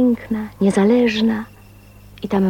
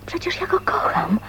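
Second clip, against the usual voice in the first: hushed, breathless whisper of love; 8 semitones higher, 5 dB quieter than usual. Acted. Woman confiding her new love, whispered. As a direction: whisper it like a secret you just discovered.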